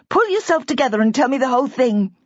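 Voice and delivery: put-on a young wife trying to reassure him; young woman Bridget. A narrator's reading. Bridget voiced by narrator.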